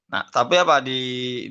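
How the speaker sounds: normal talking voice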